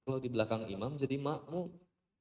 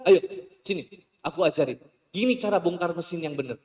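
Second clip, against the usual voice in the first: eager ustaz; 3 semitones higher, 8 dB louder than usual. Retold or imitated. Ustaz teaching girl.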